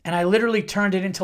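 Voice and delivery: normal talking voice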